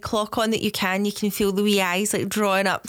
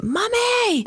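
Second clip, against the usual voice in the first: put-on high, piercing-pitched kid calling for mum; mock child yelling out for mummy; imitated child's shout; 13 semitones higher than usual. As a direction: call it out high like a demanding child. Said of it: "they'll constantly shout".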